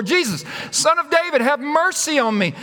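high-pitched pleading call; crying out. Acted. Blind beggar refusing to be silenced, crying out to Jesus. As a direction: call out insistently over those hushing you. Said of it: "He kept crying out all the more".

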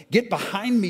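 high, loud, accusing cry; angry rebuke. Acted. Brother driving off the firstborn as a serpent, shouting. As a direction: cast the tempter away.

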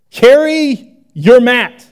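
loud, slow, drawn-out disbelief; incredulous mock. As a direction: spell out the absurd rule. Mocking leaders' absurd rule.

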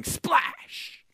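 high, hissy splash sound effect; mock splash. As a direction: act out the plunge with the word. Sound effect of Peter sinking.

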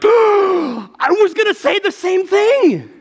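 high, slow, loud squeal of disbelief; hammed-up amazement. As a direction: stretch it out in exaggerated astonishment. Retells amazed reaction to wife.

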